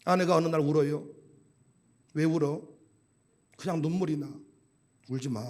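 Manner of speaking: very low, soft deadpan; subdued retold exchange. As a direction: act out a hushed marital exchange. 부부 대화를 낮게 재연. (낮고 조용하게 재연하는 부부 대화)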